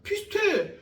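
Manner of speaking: usual speaking voice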